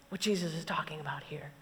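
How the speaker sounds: soft unvoiced whisper; preacher hushing to the congregation, whispering. Real, not acted. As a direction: breathe it like a secret. Whispered summary.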